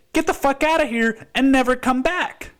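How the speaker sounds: a furious high-pitched kick-out; angry yell. Pretend. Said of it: "I'm gonna say".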